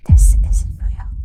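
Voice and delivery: soft unvoiced whisper. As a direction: breathe it out in a whisper. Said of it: "soft, almost like a whisper".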